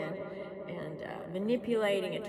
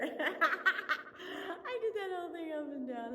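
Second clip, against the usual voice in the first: laughing through the words, slow and high; 8 semitones higher, slower than usual. Real, not acted. Laughing at herself for filming it upside down. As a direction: laugh while you say it. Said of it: "laugh cry".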